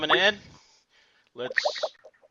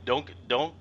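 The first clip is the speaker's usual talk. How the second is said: ordinary talk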